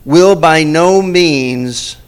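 very slow, loud "Will by no means"; slow emphatic warning. Acted. Preacher reading Jesus' warning, very slow and loud. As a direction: drag each word out as a warning.

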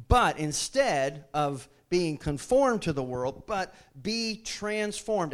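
slow, weighty delivery with a stressed but; deliberate emphatic preaching; slow emphatic contrast. Acted. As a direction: drag out each word to land the contrast. Contrasting the verse.

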